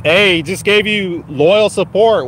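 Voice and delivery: ordinary talk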